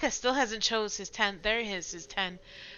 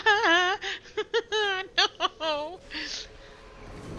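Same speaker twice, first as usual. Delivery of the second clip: high, shrieky nervous laugh; laugh-cry in real panic; panicked laugh; 12 semitones higher, 3 dB louder than usual. Real, not acted. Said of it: "I'm an arachnophobe".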